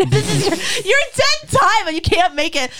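high, laughing incredulous jab; mocking disbelief. Teller ribbing a tenth-time contestant, laughing and incredulous. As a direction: laugh through a loud, high mock protest.

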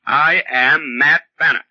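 ordinary talk

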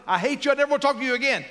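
high, loud mock tirade; angry outburst. Grown child telling off an abusive dad, angry outburst. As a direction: act out lashing out at a parent, pitch jumping up.

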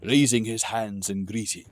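ordinary delivery, nothing marked

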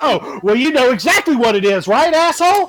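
building from sarcastic to high, loud scorn on asshole; mock angry cop. Cop getting mad at the driver, rising sarcastic anger. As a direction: rise into a sneering jab. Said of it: "And he goes".

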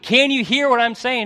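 high, loud retold cry; imitated screaming. Fisherman trying to reach the drivers, imitated screaming. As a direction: call out desperately to someone who can't hear. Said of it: "just scream as loud as he could".

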